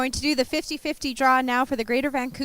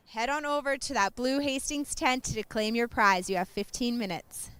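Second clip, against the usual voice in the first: usual speaking voice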